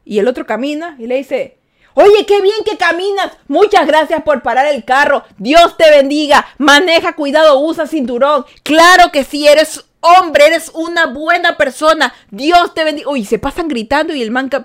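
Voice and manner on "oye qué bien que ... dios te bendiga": louder, higher, shouted blessings; kind words yelled. Retold or imitated. A pedestrian loudly thanking a driver, sounding like a shout. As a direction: yell compliments at the driver like a quarrel. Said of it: "pareciera que se estuvieran gritando".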